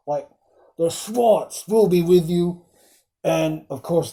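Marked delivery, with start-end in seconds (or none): 0.8-2.6 quoting a film line. Retold or imitated. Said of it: "it's quotable".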